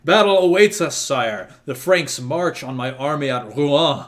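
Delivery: loud, high call to battle; shouting. Acted. Praetor shouting at the prince to march on Rouen, loud. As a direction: roar it like a commander kneeling. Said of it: "and he shouts".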